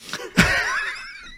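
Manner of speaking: a high, voiceless burst of laughter; breathless laugh. Real, not acted. Laughing at himself telling the story to his friend.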